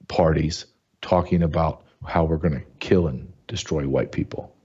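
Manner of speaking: ordinary delivery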